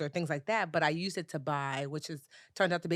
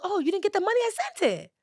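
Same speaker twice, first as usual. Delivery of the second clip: much higher, falsely innocent voice; mocking the scammer; 5 dB louder, faster than usual. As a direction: play him as a fake-surprised liar.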